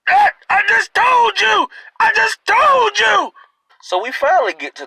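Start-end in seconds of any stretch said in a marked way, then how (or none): 0.1-3.3 put-on high strained shouting old man; granddaddy yelling again. Grandfather shouting at his daughter again, strained high yell. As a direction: holler it, slow and fed up. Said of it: "fast forward back".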